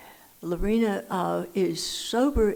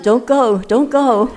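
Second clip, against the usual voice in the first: higher, much louder "Don't go" call; loud pleading; 5 semitones higher, 12 dB louder, slower than usual. Retold or imitated. Volunteer urging the lonely drinker to stay, loud higher pleading. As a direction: call out urgently to stop the caller hanging up. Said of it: "And she said".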